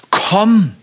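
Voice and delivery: high, loud calling command; Jesus's bold call. Acted. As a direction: call him out onto the water. Jesus calls Peter from the boat.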